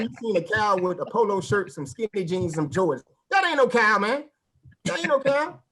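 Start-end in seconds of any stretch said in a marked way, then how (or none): 3.3-4.2 higher and louder, outraged; indignant exclamation. Retold or imitated. Indignant punchline repeated. 4.9-5.6 even higher, pitched-up disbelief; higher indignant repeat. Retold or imitated.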